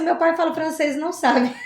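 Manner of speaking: teacher retelling her joke to students, laughing. Retold or imitated. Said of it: "eu falava assim".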